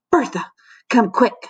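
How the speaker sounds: lightly panted urgent call; panting. A narrator's reading. Colleague racing in for help, panting. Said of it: "she panted".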